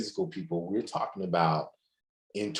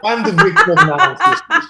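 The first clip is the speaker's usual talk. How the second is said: much higher and louder, laughing through it; laughing repeat; 14 dB louder than usual. Real, not acted. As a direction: shout it again with a laugh, hitting victim. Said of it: "Did you say victim?".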